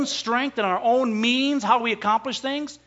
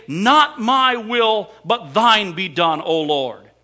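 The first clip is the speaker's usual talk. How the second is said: earnest prayer; 6 dB louder, slower than usual. Acted. Preacher voices a prayer of surrender.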